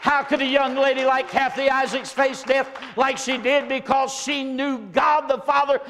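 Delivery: high, loud, fervent sermon shout; preaching at full force. Acted. Preacher rousing his congregation about Jesus, shouting high and loud. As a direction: build and ring it out to the church.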